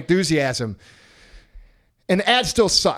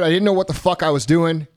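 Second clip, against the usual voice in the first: usual speaking voice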